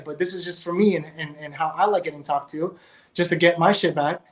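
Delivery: usual speaking voice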